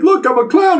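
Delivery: high, eager announcement; imitating childlike excitement. Clown cab driver showing off to the sister-in-law, excited. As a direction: show off proudly in a high voice.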